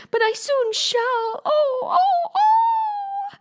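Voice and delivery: high, slow wailing scream; screaming queen. Acted. Queen shrieks about her bleeding finger, high wailing. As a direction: shriek it like a steam whistle. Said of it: "Her screams were so exactly like the whistle".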